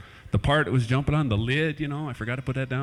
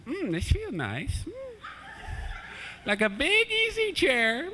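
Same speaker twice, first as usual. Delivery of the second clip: cat's contented thoughts; 3 dB quieter than usual. Retold or imitated. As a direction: savour it like a lazy cat. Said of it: "I was thinking".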